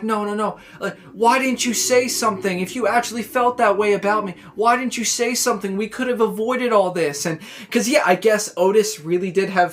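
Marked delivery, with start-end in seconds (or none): 0.0-7.2 higher-pitched pleading reproach; imitated exasperated plea, pitched up; retold upset reproach. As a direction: plead with him in a retelling. Retelling wrestler's upset confrontation.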